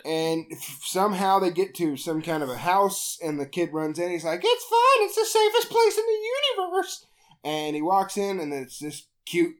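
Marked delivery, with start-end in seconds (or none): 4.4-7.1 very high-pitched mock child; little kid voice. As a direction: put on a high little-boy voice.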